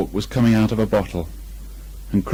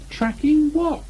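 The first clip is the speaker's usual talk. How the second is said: much higher small Piglet voice; slower than usual. A narrator's reading. Piglet triumphant to Christopher Robin, high and small. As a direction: squeak it out like a tiny piglet.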